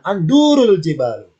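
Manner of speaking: "andurul jibalu" with a long, stressed du; lengthened du. Shown as a demonstration. Teacher showing repeated looking in Arabic, long du. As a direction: stretch the du in andurul, then jibalu. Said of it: "Dunya boleh dipanjangkan".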